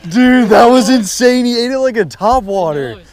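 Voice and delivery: high, loud, hyped; excited. Real, not acted. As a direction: gush about the catch. Just landed big fish.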